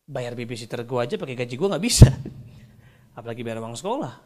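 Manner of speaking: ordinary talk, nothing marked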